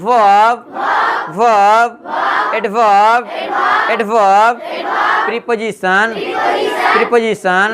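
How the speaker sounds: stretched words, held for the class to echo; drawn-out classroom drilling; say-along drill. Shown as a demonstration. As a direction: lead a slow repeat-after-me chant. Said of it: "बोलिए हमारे साथ".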